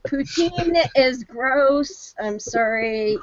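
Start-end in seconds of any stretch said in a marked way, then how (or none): 0.1-2.1 mock disgust.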